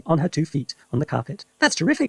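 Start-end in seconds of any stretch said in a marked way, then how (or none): 1.6-2.1 put-on boy's high delighted exclamation; thrilled boy calling to grandma; excited cry. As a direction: cry it out amazed. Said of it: "George cried".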